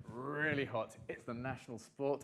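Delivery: ordinary talk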